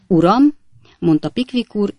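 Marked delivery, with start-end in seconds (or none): 0.1-0.6 louder, higher indignant address; sharp affronted retort. A narrator's reading. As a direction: snap back, offended. Acted quarrel, trading insults.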